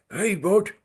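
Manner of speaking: high, put-on London accent; London chap's accent. Chap comes over in the pub, hailing Rod in a London accent. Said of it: "the chap comes over again".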